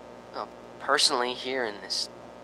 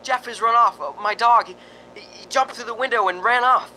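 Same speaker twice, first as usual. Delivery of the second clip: flustered report; 9 semitones higher, 3 dB louder than usual. Acted. Upset reporting dog ran.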